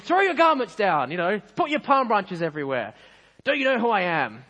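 high, loud self-important commands; indignant shouting. Acted. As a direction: shout orders like an affronted celebrity. Donkey demands a king's welcome.

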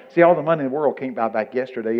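normal talking voice

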